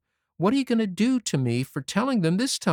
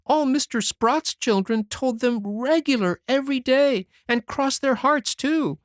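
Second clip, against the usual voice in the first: put-on high boyish voice, crying as he pleads; a sorry child weeping out his excuses; tearful; 13 semitones higher than usual. A narrator's reading. Davy crying on Anne's shoulder, begging forgiveness tearfully. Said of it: "cried stormily".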